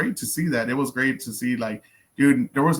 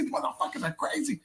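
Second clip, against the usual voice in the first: panicked quote; 14 semitones higher, 5 dB quieter than usual. Retold or imitated. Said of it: "I'm gonna be like".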